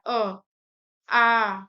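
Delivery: isolated guttural vowels अ, आ; throat vowels demonstrated. Shown as a demonstration. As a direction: say अ then आ from the throat, each one held apart. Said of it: "अ बोलेंगे तभी भी गर्दन के यहाँ".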